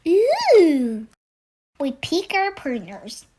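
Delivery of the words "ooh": loud, high delighted ooh; excited ooh. Acted. Owl child thrilled about the trophy, excited. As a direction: coo with delight at the prize.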